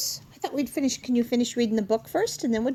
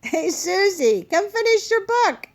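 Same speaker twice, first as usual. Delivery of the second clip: calling out; 8 semitones higher, 6 dB louder than usual. Real, not acted. Calling child back to book.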